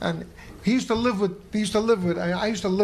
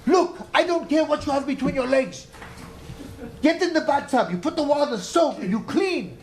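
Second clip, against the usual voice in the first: scolding Francois; 9 semitones higher than usual. Retold or imitated. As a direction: nag her like a fussy friend, half-laughing. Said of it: "Francois used to come ... and tell her".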